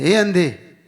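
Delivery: loud, blunt mock hail; rude calling out. Preacher showing how one insults a blind man, rude shout. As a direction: call out loudly and tactlessly.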